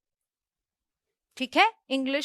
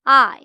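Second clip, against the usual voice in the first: "I" repeated louder, on its own; teacher's model word; 8 dB louder than usual. Shown as a demonstration. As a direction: drill "I" loud and clear. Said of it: "क्या है".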